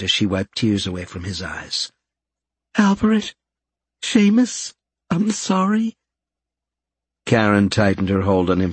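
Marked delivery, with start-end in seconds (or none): 2.8-6.0 high character voice. Acted. Fluff tearfully comforting him.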